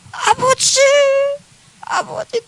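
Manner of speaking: high broken cry, fading to a breath; sobbing plea that fades to a whisper. Retold or imitated. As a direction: cry out to the Father, then break. Crying out to God in prayer.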